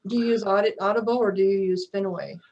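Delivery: normal talking voice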